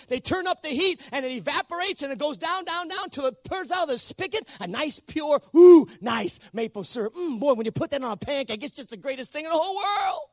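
high-pitched, gushing enthusiasm; excited. Real, not acted. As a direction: get carried away, savouring it with mounting delight. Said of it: "I'm a little bit excited about it".

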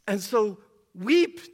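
preaching at full force. Acted. Pastor pleading with congregation, impassioned full-force preaching. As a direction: drive the point home like a revival preacher.

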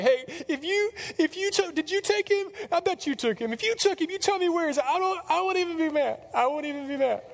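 very high-pitched, desperate bargaining; frantic pleading. Acted. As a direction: play a distraught woman begging and accusing. Voicing frantic Mary accusing, pleading with gardener.

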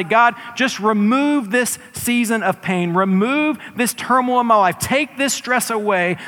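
loud, high, pleading cry to God; crying-out prayer. Pretend. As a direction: beg God to take the pain away. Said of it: "cries out that we wanna".

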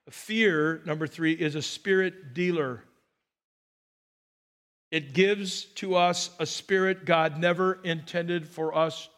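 ordinary talk

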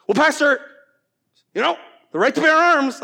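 high, loud objection; hammed-up protest to the pastor; protesting congregant. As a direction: play an indignant churchgoer arguing back.

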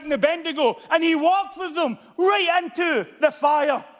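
high, loud pulpit preaching with shouted peaks; fiery revival preaching; preaching at full force. Acted. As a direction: thunder it from the pulpit. Fiery sermon passage.

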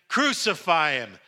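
loud crowd demand; crying out. A narrator's reading. Crowd answers Pilate about Jesus, crying out. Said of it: "they cried out again".

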